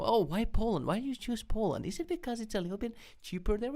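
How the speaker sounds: high, mocking questioner's voice; condescending imitation. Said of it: "ask the question in a".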